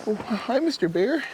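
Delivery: very high, timid squirrel greeting; innocent little squirrel. Acted. Little squirrel greeting a big bear, high innocent voice. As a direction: squeak a hesitant hello up high. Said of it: "and he goes".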